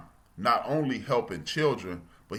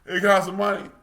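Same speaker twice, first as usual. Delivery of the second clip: homeless man asking; 10 semitones higher, 8 dB louder than usual. Retold or imitated. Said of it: "the second dude will go".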